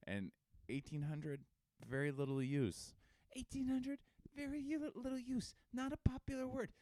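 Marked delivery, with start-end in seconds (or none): 3.3-6.7 high squeaky announcer voice; silly high voice. Acted. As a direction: read the graph in a squeak. Reading graph in funny voice.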